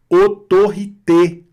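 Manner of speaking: "Autorité" syllable by syllable, high and loud, closed é at the end; closed final É. Shown as a demonstration. Teacher demonstrates French for learners, "Autorité" with closed é. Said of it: "tem o som fechado".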